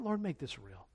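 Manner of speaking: very soft, low plea; quiet prayer. Retold or imitated. Preacher asking if you ever prayed that, hushed plea. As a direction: murmur it privately to God.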